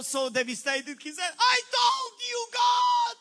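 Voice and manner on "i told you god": high, loud exasperated shout; frustrated cry. Retold or imitated. Devastated pastor after eight-dollar offering, frustrated shout. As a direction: throw it up at God in frustration. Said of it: "He was so frustrated".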